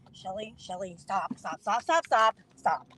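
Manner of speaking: low, soft, repeated plea; hushed urgent restraining. Retold or imitated. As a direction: imitate a friend quietly reining someone in. Friend trying to restrain her.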